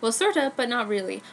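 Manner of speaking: plain reading voice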